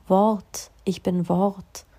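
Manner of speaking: very slow Wort, ich bin Wort; intoning the word mantra. Shown as a demonstration. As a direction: chant it slowly. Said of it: "Und dann spricht er weiter".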